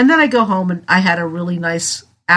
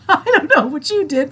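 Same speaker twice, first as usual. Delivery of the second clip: high, giggling through the words; a real laugh spilling into the line; 9 semitones higher than usual. Real, not acted. Owning up to a big holiday weekend, laughing. Jokes about eating too much.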